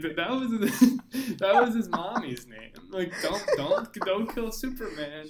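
hammed-up damsel begging, cracking up; Lois Lane. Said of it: "Direct quotes".

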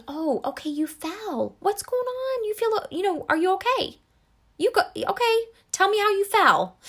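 a tender parent asking what happened; gentle validating parent. Retold or imitated. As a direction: calmly invite the child to talk. Parent validating fallen child.

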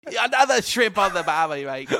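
loud, high mock Australian; full Australian accent. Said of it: "I am going full Australian".

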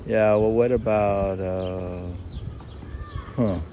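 usual speaking voice

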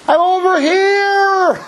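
high, slow shout while laughing; laughing high yell; God calling out. Retold or imitated. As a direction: call out like God waving from afar. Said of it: "And God's like".

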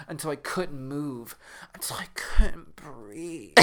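breathless unvoiced whisper; a horror-story whisper trailing off. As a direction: whisper it like the air is gone. Dramatic reading of the book.

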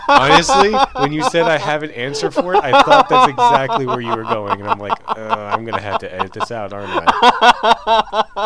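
talking through big laughs; laughing. Real, not acted. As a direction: laugh while getting the words out. Laughing hard telling story.

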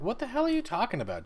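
incredulous. Retold or imitated. Robin, quoted, scoffing at Jake.

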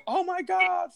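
sudden high startled cry; shocked gasp. Retold or imitated. Partygoers reacting to a bottle smash, high shocked cry. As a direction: squeal it like the shocked crowd. Crowd freaking out after bottle.

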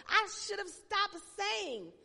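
loud, high cry; preached emphasis. Real, not acted. Pastor crying out her regret to the church. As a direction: push the regret out hard.

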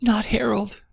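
a high, moaning "Not Harold". Acted. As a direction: moan it in despair. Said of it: "Claire moaned".

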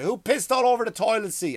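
high, loud mock yell of an annoyed mother; hammed-up mother calling out through the house. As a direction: bark it like a fed-up Irish mum. Mother yelling, retold.

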